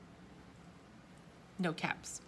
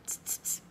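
three crisp tongue clicks; monkeys' mocking tsks. A narrator's reading. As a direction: click the tongue back in mockery. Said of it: "back at him and said".

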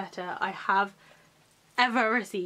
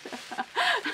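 high giggle. Real, not acted. Delighted by pencils.

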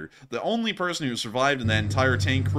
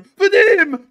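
high, loud mock shout; imitated yell; 12 dB louder than usual. Soldier spotting the drone over his friend, high mock yell. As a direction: shout the name in comic alarm. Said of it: "this guy yells out".

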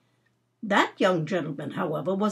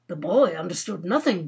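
plain reading voice

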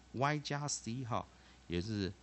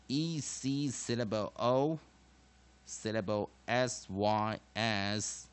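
loud, slow, measured classroom drill; emphatic teacher modelling each syllable for students to repeat; pronunciation drill recitation; 4 dB louder, slower than usual. Shown as a demonstration. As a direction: recite it piece by piece with long pauses.

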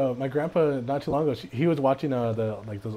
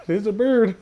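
laughing; 6 semitones higher than usual. Acted. Repeats catchphrase while laughing.

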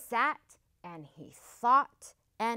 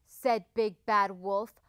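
ordinary delivery, nothing marked

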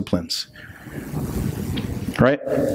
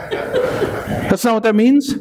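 laughing; 10 semitones higher, slower than usual. Real, not acted. Laughs at his own joke.

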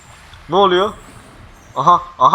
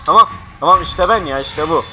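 usual speaking voice

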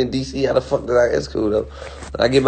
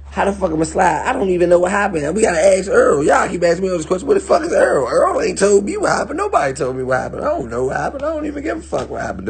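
exasperated; 8 semitones higher than usual. Real, not acted. Exasperated rant about questions.

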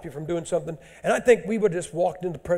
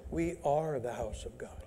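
hushed emphasis; 4 semitones lower, 10 dB quieter than usual. Real, not acted. Key verse stated.

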